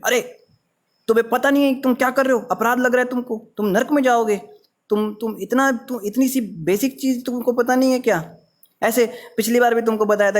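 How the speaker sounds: high, fast, flustered telling-off; alarmed scolding. Retold or imitated. Old devotee scolding a newcomer, flustered and high. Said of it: "एकदम घबरा जाते हैं जो पुराने भक्त होते हैं".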